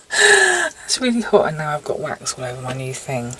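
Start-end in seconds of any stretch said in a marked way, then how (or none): 0.1-1.5 much higher, slow pained cry; real pain, high and drawn out; ouch it's hot. Real, not acted. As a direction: yelp at the burning wax. Hot wax on hand.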